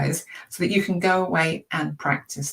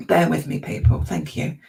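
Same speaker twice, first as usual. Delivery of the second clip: usual speaking voice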